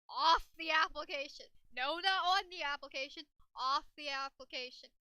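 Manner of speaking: loud emphatic rant; worked-up, forceful scolding at full volume; exasperated shouting. Pretend. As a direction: yell each instruction like they keep getting it wrong. Exasperated yelling about the mouse.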